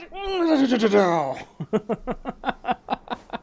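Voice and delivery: high stuttered firing noise breaking into giggles; spray out a silly rapid-fire noise, then crack up; manic babble into laughter. Retold or imitated. Streamer mimicking rapid-fire archery, high babble into laughter.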